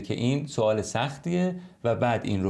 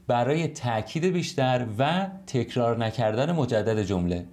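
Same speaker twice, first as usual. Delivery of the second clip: usual speaking voice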